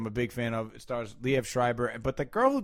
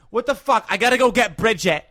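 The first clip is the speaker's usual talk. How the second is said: high, loud mock Southie Boston accent; hardcore Boston accent; 8 semitones higher, 7 dB louder than usual. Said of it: "fucking Boston doesn't sound like that".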